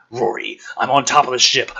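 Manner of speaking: dad yelling to his son over the wind; yelling into the comm. Acted. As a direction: call out over rushing wind. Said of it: "yells into the comm over the rushing wind".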